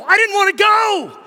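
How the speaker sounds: high, loud protest; loud whine of protest; exasperated outburst. Real, not acted. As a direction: shout your reluctance for comic effect. Preacher exclaims his own reluctance.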